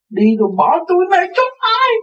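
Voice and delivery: high, loud mock wailing; imitating a tearful old man's lament; imitated wail. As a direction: wail it like the weeping old man, hammed up. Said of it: "Khóc vậy đó".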